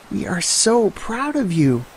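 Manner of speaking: mother praising her son; proud mom. Acted. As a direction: beam with pride at your child.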